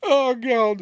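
high, slow horrified cry; high slow mock horror; imitating Veronica Cartwright. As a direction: mimic a real shocked actress. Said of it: "Veronica Cartwright actually going".

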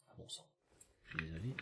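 quiet, catching after a cough; frog in throat. Real, not acted. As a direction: clear a froggy throat while speaking. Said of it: "j'ai un truc dans la gorge".

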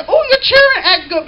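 high, loud gushing compliment with a laugh; mock admiring onlooker. Onlooker praising the children, high and laughing. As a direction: gush like an impressed neighbour.

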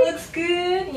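sing-song. Acted. Sudden high sing-song.

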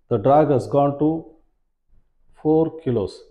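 normal talking voice

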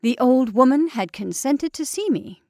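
plain reading voice

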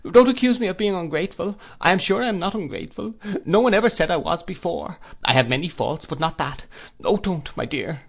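high-pitched, distressed protest; crying out. A narrator's reading. Mother denies being ungrateful, crying out in protest. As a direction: plead innocence urgently. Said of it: "cried my mother".

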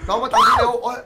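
high, loud frantic shout. Real, not acted. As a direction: scream for him to come. Urgently calling teammate over.

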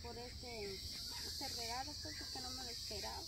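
shy thanks. Real, not acted. Thanking Marta for the unexpected gift, hushed. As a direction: murmur it, almost to yourself.

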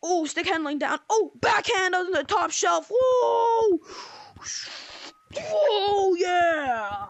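rising to a whooping goal shout; excited play-by-play. Pretend. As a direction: call the goal like a fan.

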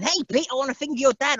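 high, mocking lad's voice, a light send-up; a lightly voiced mock of the other actor; cheeky bloke voice. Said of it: "there's that bloke again going".

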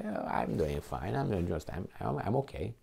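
lightly mock someone content while others suffer; a hint of lazy complacency; smug complacent voice. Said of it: "in the name of justice to say".

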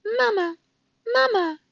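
tiny high-pitched chick voice; peeping gosling. A narrator's reading. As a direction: squeak it like a hungry hatchling. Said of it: "peeped the gosling".

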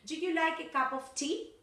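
high-pitched question climbing up on "tea"; rising intonation. Shown as a demonstration. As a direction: let "tea" lift at the end, as a teacher's model. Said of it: "rising intonation and falling intonation".

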